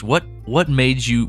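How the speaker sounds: ordinary talk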